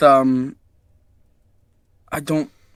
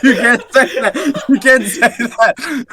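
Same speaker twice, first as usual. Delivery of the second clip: slow, high, laughing fake outrage; drawn-out playful scold; mock scolding; 7 semitones higher, 5 dB louder, slower than usual. Repeated mock scolding, slower.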